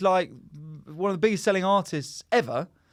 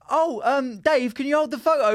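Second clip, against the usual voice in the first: high, eager put-on fan voice; mimicking a fan; 9 semitones higher, 4 dB louder than usual. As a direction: play a gushing fan pushing a favour. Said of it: "people would be like".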